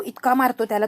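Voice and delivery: plain reading voice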